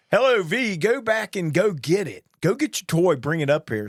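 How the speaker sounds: put-on voice of a coworker giving cheery orders; imitating Jack. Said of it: "where Jack goes".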